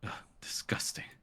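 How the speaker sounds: whispered ugh of distaste; disgusted whisper. Acted. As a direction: sneer it under your breath. Noble reacts with disgust.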